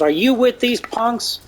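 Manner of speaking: mock guard asking if he's with the punks; tough guard voice. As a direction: sneer the question at him like a bouncer. Guard points at speaker, challenges.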